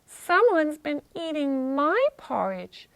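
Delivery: mother bear at an everyday volume; medium-voiced Mama Bear. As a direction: lift it into a mid-high bear voice. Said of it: "in her medium voice".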